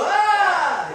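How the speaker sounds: high loud yelp; playful mock alarm; refusing yelp. Shown as a demonstration. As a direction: shriek to send it back. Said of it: "He can go".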